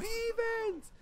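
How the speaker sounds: very high screechy squeal; hammed-up falsetto cry; mock freak-out. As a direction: shriek it in a small, high voice. Freaking out cartoon style.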